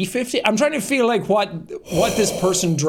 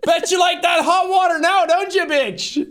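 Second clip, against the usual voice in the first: higher, jeering taunt at a shark; mock taunt; 10 semitones higher than usual. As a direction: jeer at the shark from the shore. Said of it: "just on the shore being like".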